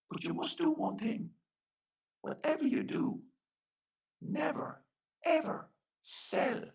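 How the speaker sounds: high-pitched, measured warning; fairy warning. Acted. Fairy man gives Flanagan his one condition, high and deliberate. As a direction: lay down the rule word by word, with pauses.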